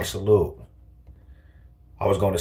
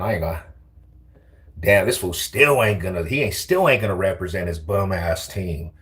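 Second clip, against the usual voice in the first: ordinary talk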